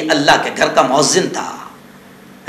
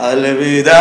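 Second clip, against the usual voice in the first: mock wailing farewell; 4 semitones lower, slower than usual. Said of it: "रो रो कर उसने mic पे शुरू कर दिया".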